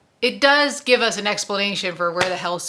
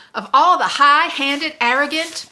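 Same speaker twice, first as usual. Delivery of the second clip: higher and louder indignant sputter; outraged outburst; 8 semitones higher, 9 dB louder than usual. Acted. Susanna sputters with outrage at Roland's arrogance. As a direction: burst out in offended fury, then break off.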